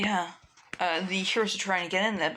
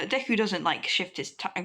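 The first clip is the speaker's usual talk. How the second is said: ordinary delivery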